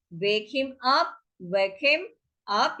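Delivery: drawn-out teaching delivery; slow demo phrase; slow model phrase. Shown as a demonstration. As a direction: stretch it so learners can follow. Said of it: "teacher repeats model phrase".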